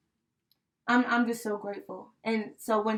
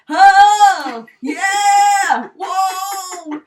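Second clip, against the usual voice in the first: high, loud whooping shout; thrilled ride yell; 10 semitones higher, 14 dB louder, much slower than usual. Retold or imitated. Grandpa on the ride, whooping and shouting loudly. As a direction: yell it like the ride just dropped. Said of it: "cracking up at his face because he looked so funny".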